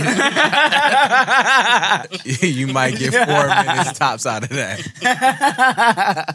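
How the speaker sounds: slow, laughing through the words. Real, not acted. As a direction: laugh it out slowly. Laughing mock-slow reply.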